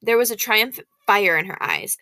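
usual speaking voice

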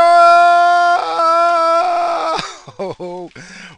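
very high, loud shrieking laugh. Acted. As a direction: cackle loud after the intro. Shouted laugh after intro.

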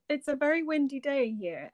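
ordinary delivery

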